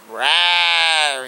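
a loud growling roar; imitated roar. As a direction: roar it like the animal in the dark. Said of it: "it growled again".

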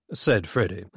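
ordinary delivery

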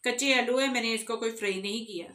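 ordinary talk, nothing marked

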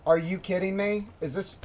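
normal talking voice

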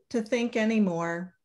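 ordinary delivery, nothing marked

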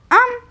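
sudden high shouted "Ам!"; playful loud chomp sound; loud mock chomp. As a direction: bark out a cartoon bite. Sudden loud bite noise.

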